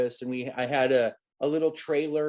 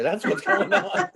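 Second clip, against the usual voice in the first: breaking into laughter, pitch jumping up; laughing. Real, not acted. Actor confesses to the wedgie on screen, laughing. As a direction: say it through a real laugh.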